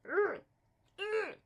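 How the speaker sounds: exasperated high ugh; frustrated groan. Real, not acted. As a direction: groan at the bad luck. Frustrated at card limits.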